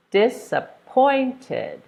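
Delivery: slow dis-ap-POINT-ed, POINT lifted; disappointed syllable by syllable, stress on POINT; third-syllable stress. Shown as a demonstration. Teacher sounding out disappointed, slow, stressed on POINT. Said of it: "stress is on the third one".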